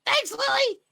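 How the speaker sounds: very high, loud cartoon voice; high squeaky voice. GM voices Rocky.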